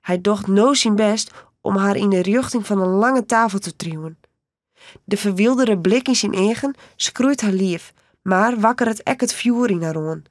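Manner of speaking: plain reading voice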